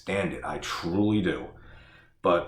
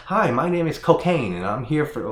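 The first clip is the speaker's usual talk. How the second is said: mock reporter intro; 6 semitones higher, 5 dB louder than usual. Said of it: "that news reporter ... and said".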